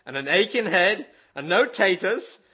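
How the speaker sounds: indignant outburst. Retold or imitated. Billy Bray scolding the devil, loud indignant Cornish. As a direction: fling the grievances back at the devil.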